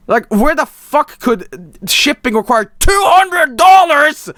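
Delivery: loud, slow indignant yell; ranting shout. Real, not acted. Streamer ranting at shipping price, loud. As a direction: build into a shout.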